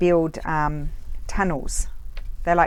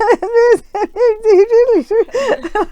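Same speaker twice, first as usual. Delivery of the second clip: very high, loud, hammed-up gibbering; speechless panicked babble; 10 dB louder than usual. Trying to tell her boss about the spider, mock speechless panic. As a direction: squeal and stammer without forming words. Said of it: "I couldn't even say it".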